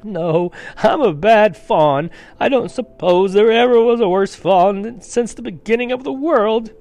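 loud, high self-blaming wail; hammed-up tearful outburst; sobbing. A narrator's reading. The fawn calls himself the worst ever, wailing. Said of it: "between his sobs".

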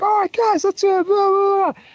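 loud, very high stage yell; mock frontman rallying a crowd at full pitch; crowd-hyping shout. Retold hype shouts to the crowd.

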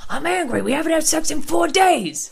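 hammed-up Joe Gorga complaint; mocking Joe Gorga's grumpy complaint; Joe Gorga impression. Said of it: "Joe Gorga being like".

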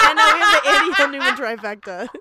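loud fast laughing announcement. Real, not acted. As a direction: burst out laughing through it.

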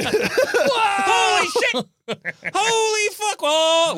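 high, excited disbelief. Real, not acted. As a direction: yelp with amazed glee. Excited reaction to a video.

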